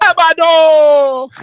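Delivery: loud, slow, high call of Abado!; gang call. Calling out to the gang in jail, long loud slow call. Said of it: "it's actually a gang call".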